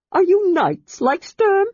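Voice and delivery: bursting with hope; excited Kender. A narrator's reading. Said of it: "he asked excitedly".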